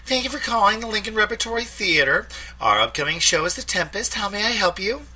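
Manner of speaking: high, bright phone greeting; young receptionist. A narrator's reading. Theater receptionist answering the phone, high polite voice. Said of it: "a young woman's voice answered".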